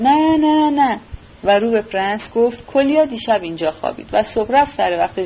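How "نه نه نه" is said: higher, louder hurried no no no; flustered shout. Acted. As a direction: jump in fast to stop him, loudly. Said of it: "به دست و پا افتاد و شتابان پیش آمد و فریاد زد". (فریادی دستپاچه و بلند)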